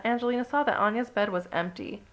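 usual speaking voice

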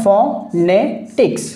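ফনেটিক্স slowly split into three syllables, fo-ne-tiks. Shown as a demonstration. Teacher showing learners the syllables of ফনেটিক্স, said slowly piece by piece. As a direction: break ফনেটিক্স into fo, ne, tiks with clear gaps. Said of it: "কয়টা syllable হয়? তিনটা".

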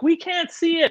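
high whiny imitation; mock whiny viewer. Audience complaining, mocked.

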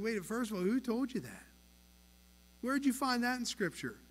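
lightly playing a whiny preschooler. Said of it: "we sound like a bunch of preschoolers".

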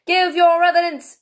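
high, loud royal demand; King. A narrator's reading. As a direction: order it in a ringing kingly voice. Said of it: "said the King".

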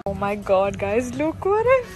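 usual speaking voice

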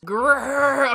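hammed-up wolf growl; playful growl. Playing a wolf, charging.

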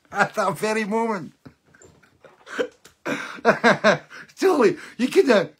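high laughing through the words. Real, not acted. Telling friends the absurd coincidence, laughing loudly. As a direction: crack up while talking.

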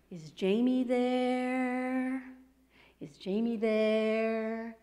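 put-on slow French accent, stretching "there"; a faint far-off Frenchman calling slowly; drawn-out French-accented call, "there" held long; French-accented ghost voice. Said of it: "the voice had an accent and prolonged the syllable "there".

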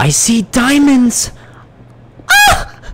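a real burst of joy over treasure; excited squeal. Real, not acted. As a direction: yelp with delight at the find. Finds diamonds in the game.

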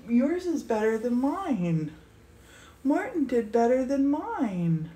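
ordinary delivery

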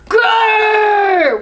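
loud, high growling roar; great roar. A narrator's reading. Monkey leaping out disguised as lion, roaring. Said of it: "a great rumbling roar".

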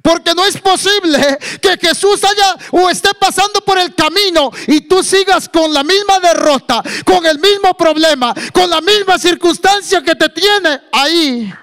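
high, loud preacher's voice; full-force preaching. Acted. Preacher retells the blind man hearing the call, full-force preaching. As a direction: launch into high, forceful proclamation.

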